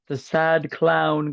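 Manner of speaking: slow drawn-out sad clown wail; mournful sad clown whine. Pretend. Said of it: "cut that shit out".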